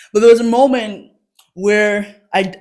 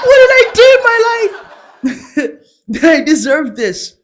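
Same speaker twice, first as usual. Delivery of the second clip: exasperated mom; 8 semitones higher, 5 dB louder than usual. Retold or imitated. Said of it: "that frustration of".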